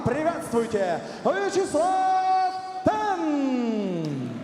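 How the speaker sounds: long sung-out name call; booming stage introduction. Acted. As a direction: stretch the name out high. Announcer calls the fighter's name.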